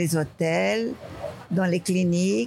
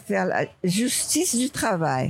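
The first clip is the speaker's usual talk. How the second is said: ordinary talk, nothing marked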